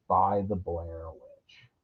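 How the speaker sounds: low, slow creepy narrator voice; spooky ominous read. Pretend. Reading the synopsis to viewers, low and slow like a trailer. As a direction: drop into a creepy movie-trailer voice.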